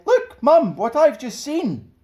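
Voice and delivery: put-on high, eager child's call; excited little girl. Girl tells her mother about the flying saucer, high and eager. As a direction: tug at Mum and blurt it out.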